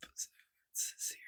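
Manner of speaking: whispered to herself while reading. Real, not acted. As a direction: breathe the word out, unvoiced. Reading search result to herself.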